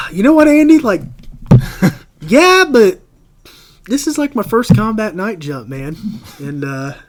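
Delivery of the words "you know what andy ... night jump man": much higher and louder, wound up and nervous; keyed-up outburst. Retold or imitated. On the Blackhawk, he tells Andy it's his first combat jump, keyed up and loud. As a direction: blurt it out all worked up and jittery.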